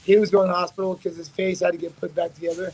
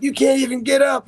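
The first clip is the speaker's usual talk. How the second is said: higher-pitched retold taunt; trash talk; 7 semitones higher, 4 dB louder than usual. Rival heckles fighter lying on IV, high-pitched taunt. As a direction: goad him in a raised, mocking pitch. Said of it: "we start talking shit".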